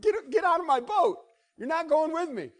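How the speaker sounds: high, loud comic shooing; Jesus playfully ordering the man out; mock-stern Jesus. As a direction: shoo him out of the boat. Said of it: "Jesus said".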